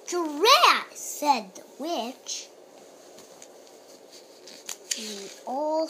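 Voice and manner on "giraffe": loud straining grunt; effortful grunt. Acted. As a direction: heave like pulling hard. Witch straining at the pumpkin.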